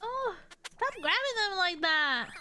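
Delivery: loud, high squeal of protest; amused protest. Real, not acted. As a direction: cry out at the cute sight. Reacting to animals being grabbed.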